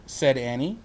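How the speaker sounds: usual speaking voice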